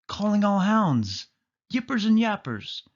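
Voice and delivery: high, ringing call to every dog. A narrator's reading. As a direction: summon the hounds far away. Pongo calls out to all dogs.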